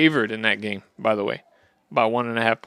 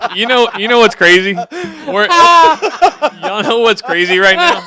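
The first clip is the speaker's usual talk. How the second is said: incredulous; 9 semitones higher, 8 dB louder, slower than usual. Acted. Mock-excited rant about bunny suit.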